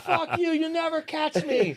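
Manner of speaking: high, slow mock taunt through laughter; defiant taunt. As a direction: crow it at your pursuers, half laughing. Retold taunt while running from guards.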